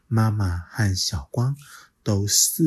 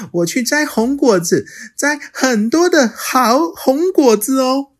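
put-on high, louder delighted child; happy child; 9 dB louder than usual. Little dinosaur to mother, high and delighted. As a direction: beam with relief.

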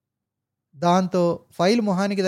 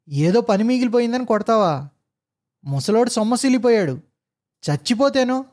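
higher, louder furious tirade; military outburst; 4 semitones higher, 3 dB louder than usual. Acted. Driver bursts out at officer with military fury. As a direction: explode at the officer. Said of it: "military ఊపుతో విరుచుకుపడిపోతుంటే".

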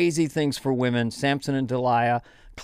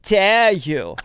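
higher, slow, indignant; Greta Thunberg impression; 6 semitones higher, much slower than usual. Greta scolding world leaders, slow indignant higher voice. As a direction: drag out the outrage slowly. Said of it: "The only woman I do is Greta Thunberg".

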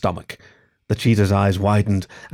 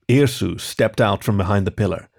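usual speaking voice

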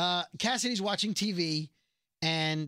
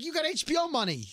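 mock agent pitch; 11 semitones higher than usual. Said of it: "He's like".

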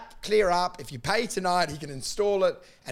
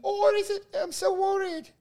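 much higher put-on old lady voice; mock granny. Imitating a worried granny answering the roof scammer, mock high old lady voice. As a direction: play a fretful granny, high. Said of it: "voicing the granny".

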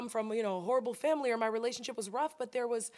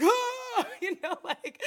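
high laughing ah; 12 semitones higher, 7 dB louder, slower than usual. Real, not acted. Recalling a painful question, laughing it off.

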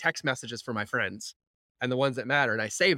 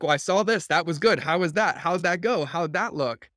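higher and louder, rapid eager check-ins; eager questions; 5 semitones higher, 5 dB louder than usual. Retold or imitated. Online stalker friend quizzing her friends about their posts, higher and louder with eagerness. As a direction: pepper a friend with keen little questions. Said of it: "I'm like".

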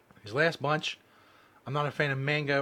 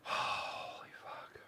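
slow breathless whisper; whispered curse; 11 dB quieter, much slower than usual. Real, not acted. Mouth burning, drawn-out gasp.

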